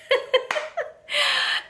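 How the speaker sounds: high squealing laugh. Real, not acted. Vlogger recalling her odd line, squealing laugh.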